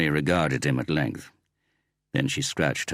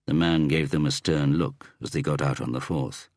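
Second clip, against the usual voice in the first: ordinary delivery, nothing marked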